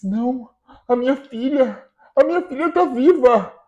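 incredulous joy. Acted. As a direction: disbelief swelling into elation. Pai scopre che la figlia è viva.

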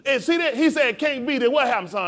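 full-force preaching, high and loud. Real, not acted. Preacher telling the congregation he will walk among them, preaching. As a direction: preach it with driving intensity.

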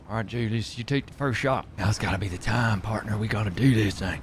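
low Southern outlaw voice. Pretend. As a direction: play the tough frontier partner. Cowboy roleplay with in-game partner.

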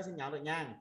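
normal talking voice

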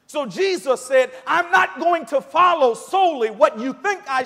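high, very loud impassioned sermon delivery; full-force preaching. Real, not acted.

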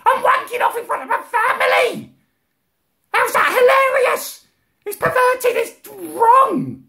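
shrieking, very high outburst; outraged. Retold or imitated. Exploding at mum's excuse, outraged. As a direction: shriek in horrified disbelief.

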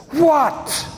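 put-on sharp, high, put-out teenage "What?" thrown at mom; annoyed teen what. Boy embarrassed by his mother's touch, indignant high "What?". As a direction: snap "What?" like an embarrassed ten-year-old. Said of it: "he turns to her and says".

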